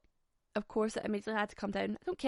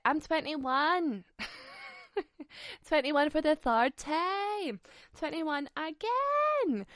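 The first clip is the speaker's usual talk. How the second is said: slow, higher, teasing voice; playful age denial; 7 semitones higher, 3 dB louder, slower than usual. Pretend. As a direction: insist on twenty-one with mock pride. Joking about her age.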